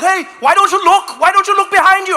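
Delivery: high, loud shouted scolding; retold scream. Angry cyclist screaming at comic, loud scolding. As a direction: yell at the pedestrian to look behind. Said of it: "One guy screamed at me".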